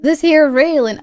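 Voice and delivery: put-on old-timer's weird drawling accent; put-on old-timer accent, punched hard. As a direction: play a grizzled character in a hammy accent. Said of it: "do it with the weird accent".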